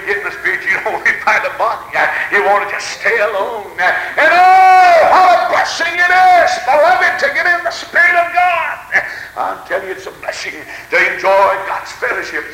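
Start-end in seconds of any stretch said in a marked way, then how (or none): 4.2-8.8 high, sustained cries of praise; exultant preaching shout. Real, not acted. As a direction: cry out the blessing at full force. Preacher exults at full force.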